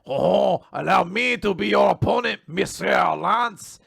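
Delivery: a hammed-up French gentleman; French accent. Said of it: "apparently very French".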